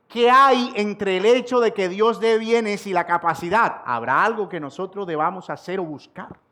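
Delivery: impassioned preaching. Acted. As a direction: press the congregation with question after question.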